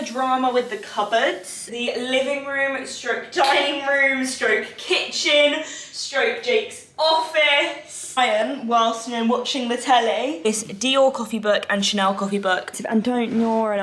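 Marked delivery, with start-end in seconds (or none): 1.7-8.1 slowing, drawn-out room list; building slow list. Real, not acted. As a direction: stretch each stroke longer. Listing rooms for effect.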